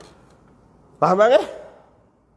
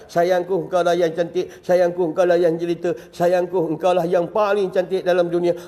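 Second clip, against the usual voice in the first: hushed husband praising his wife; tender love words; 6 semitones lower than usual. Acted.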